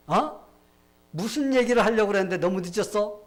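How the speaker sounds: high, loud, incredulous demand; startled questioning. Acted. King demanding what his grandson meant, loud and startled.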